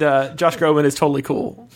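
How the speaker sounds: ordinary delivery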